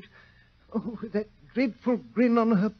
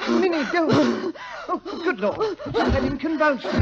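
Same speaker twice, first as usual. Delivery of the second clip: alarmed; 7 semitones higher, 3 dB louder than usual. Acted. Brother sees convulsions.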